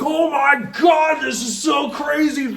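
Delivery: hammed-up stunned tripper; amazed exclaim. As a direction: gasp it out in wonder. Retelling being stunned by visions.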